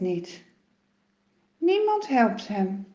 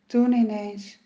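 usual speaking voice

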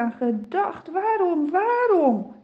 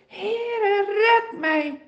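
terrified cry for help; 7 semitones higher, much slower than usual. A narrator's reading. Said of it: "Hij roept".

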